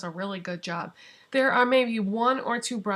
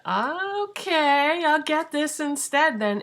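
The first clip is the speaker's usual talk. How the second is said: high, drawn-out rising okay, louder; retold happy decision; 7 semitones higher, 5 dB louder, slower than usual. Telling viewers how she chose the soap instead, playfully decided. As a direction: play up the sudden decision, pitched well up. Retells her decision after the search.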